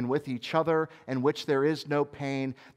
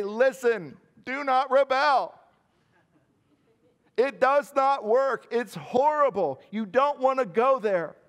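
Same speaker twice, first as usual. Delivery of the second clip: higher, louder, slow and pleading; urgent warning; 10 semitones higher, 5 dB louder, slower than usual. Pretend. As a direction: warn them earnestly, word by word. Urgent warning to unfallen beings.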